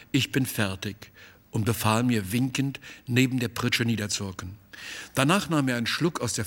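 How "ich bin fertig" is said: ordinary delivery, nothing marked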